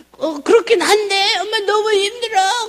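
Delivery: high, slow tearful whine; retold weeping. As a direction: plead through tears. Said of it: "그러고 또 막 울었어요".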